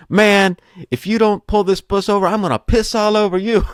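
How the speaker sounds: high-pitched mock threat; retold yelling. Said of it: "they're yelling back and forth".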